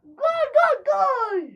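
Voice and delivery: high shouted goal call; screaming football commentator. Acted. (bağıran maç spikeri)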